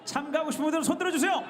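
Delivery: rally the crowd for volunteers; calling out. Real, not acted.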